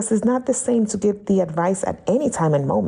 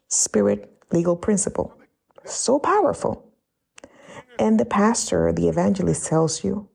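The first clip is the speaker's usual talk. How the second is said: normal talking voice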